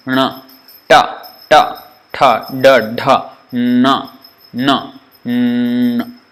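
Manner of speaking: "ta, da, na" with tongue curled back; retroflex sounds. Shown as a demonstration. Teacher demonstrating retroflex series to students. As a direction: model each retroflex syllable. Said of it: "retroflex resonant".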